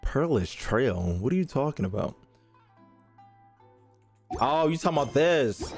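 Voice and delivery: ordinary talk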